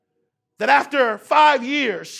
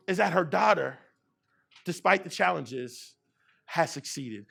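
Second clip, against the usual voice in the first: dropped to a hush; 7 semitones lower, 9 dB quieter than usual. Acted. Dramatic hush at story's climax.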